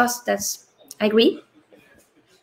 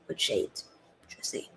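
sly aside; 12 semitones lower, 15 dB quieter than usual. Real, not acted. Streamer teasing Liverpool fans, in a much softer sly aside.

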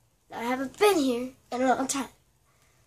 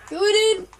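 excited shout; 9 semitones higher, 9 dB louder than usual. Real, not acted. Excited outcry in game.

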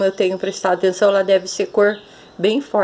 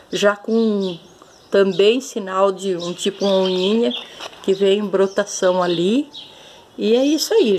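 normal talking voice

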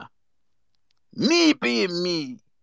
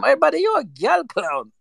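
higher, gleeful insult; retold put-down; 9 semitones higher than usual. Taunting the mascot in a higher gleeful voice. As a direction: land the insult with relish. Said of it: "Said to him".

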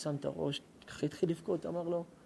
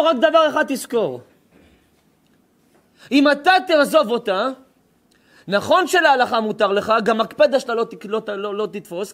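loud, high, commanding rabbi; stern warning; 3 semitones higher, 5 dB louder than usual. Acted. Rabbi warns the young man not to leave her, loud and stern. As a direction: boom it out like a rabbi laying down the law.

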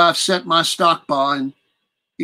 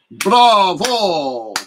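loud, higher villain's bravo; a captor's sly applause cutting in; mocking bravo; 4 semitones higher, 4 dB louder than usual. Captor mockingly applauding the prisoner's poem, loud.

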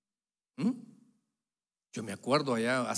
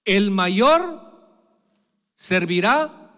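louder, hammer each word; loud emphasis; 5 semitones higher, 11 dB louder, much slower than usual. Acted. Pastor proclaiming the prophecy to the church, loud. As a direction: proclaim it loudly to the congregation.

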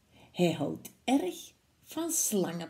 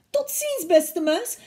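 much higher, alarmed cry; frightened fox; 10 semitones higher, 7 dB louder than usual. Acted. Fox sees the Gruffalo and cries out in alarm. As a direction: yelp it in sudden fear. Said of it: "riep hij".

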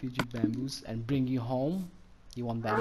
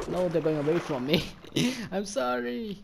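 playful dismay; 6 semitones higher, 3 dB louder than usual. Real, not acted. Turtles flee, pleads after them.

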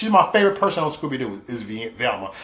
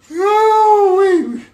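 delighted ooh-wee; 8 dB louder than usual. Real, not acted. Reacting to imagined follower war.

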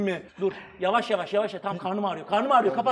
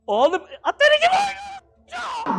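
screaming while falling. Real, not acted. Pain jolt, laughing.

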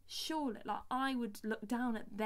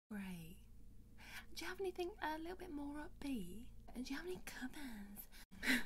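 soft, higher, mock-polite voice; unimpressed A&R woman; 6 semitones higher, 5 dB quieter than usual. A&R woman asking singer for covers, mock-sweet dismissal. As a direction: imitate a dismissive judge with false sweetness. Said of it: "Sorry, I'm getting angry".

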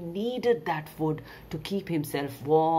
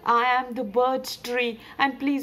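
raised-pitch tree character voice; higher, earnest plea; pleading birch tree; 6 semitones higher, 4 dB louder than usual. Acted. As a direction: introduce yourself and beg. Story's tree answers, pleading.

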